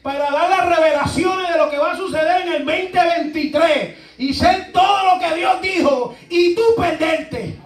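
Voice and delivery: loud, high preaching with a laugh; preaching at full force. Real, not acted. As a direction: drive the warning home. Preaching climax. (predicando fuerte con risa)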